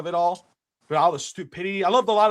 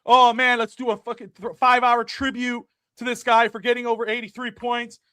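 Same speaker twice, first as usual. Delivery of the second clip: mock gushing media; 6 semitones higher, 5 dB louder than usual. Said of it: "They'd be like".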